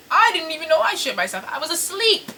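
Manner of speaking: indignant protest. Retold or imitated. Protesting to the laughing sister, high indignant squeal. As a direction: protest your innocence, voice jumping up.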